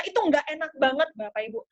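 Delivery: usual speaking voice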